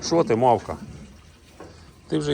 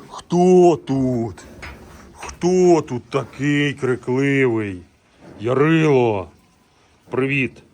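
louder, higher, slow teasing call; playful raised call at the bull; 4 semitones higher, 7 dB louder, much slower than usual. Real, not acted. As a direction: tease the noisy bull loudly. Calls toward noisy bull.

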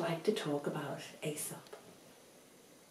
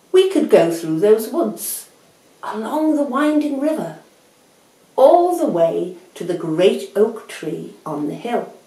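high, loud, sweeping suggestion; tortoise, keenly proposing; 5 semitones higher, 10 dB louder than usual. Acted. Tortoise proposes a longer route to Hare, high emphatic voice. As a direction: map out the route with lifted voice.

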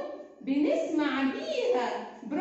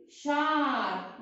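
Arabic teacher modelling a word slowly; slow model word; much slower than usual. Shown as a demonstration. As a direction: stretch out شعر very slowly. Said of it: "يلا كلنا".

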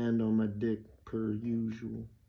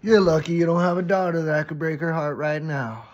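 loud, raised-pitch angry retort; pissed; 5 semitones higher, 11 dB louder than usual. Acted. Insulted student answering the teacher back, pissed. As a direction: fire back hard, acting out indignation. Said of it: "I stand up pissed".

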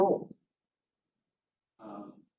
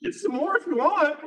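much higher, louder breezy offer; mock generous offer; 14 semitones higher, 7 dB louder than usual. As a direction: offer more cake with false cheer. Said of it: "You know, just like".

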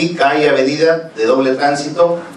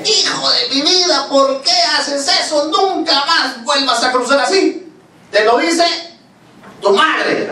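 shouting mother; 12 semitones higher than usual. Acted. Mother forbidding her child to cross like that, high-pitched shouted scolding. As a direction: scold at a high pitch, stretching each phrase slowly. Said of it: "Cuando le gritó".

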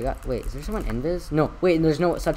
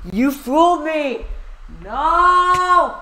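high, drawn-out scream of protest; over-the-top outraged shout; betrayed yell; 12 semitones higher, 8 dB louder, much slower than usual. Real, not acted. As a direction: wail it loud and slow. Dramatic yell at being fooled.

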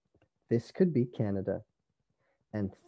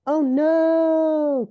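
high, loud, long wail; alarmed cry; 9 dB louder than usual. A narrator's reading. Sisters react to the creeping beast, high alarmed cry. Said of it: "cried her sisters".

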